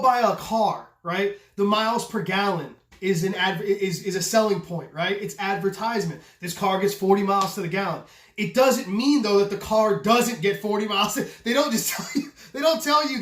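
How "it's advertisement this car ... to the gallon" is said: ordinary delivery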